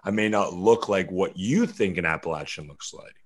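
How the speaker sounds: usual speaking voice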